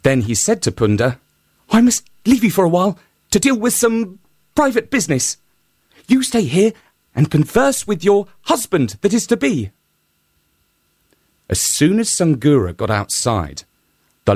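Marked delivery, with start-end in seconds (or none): 1.7-9.7 high, quick, smooth hare voice; hare's voice. Acted. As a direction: pitch up and hurry as the sly hare. Hare tricks the donkey.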